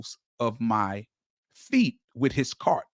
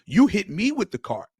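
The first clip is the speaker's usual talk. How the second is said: retold anger; 6 semitones higher, 4 dB louder than usual. Reliving angry confrontation.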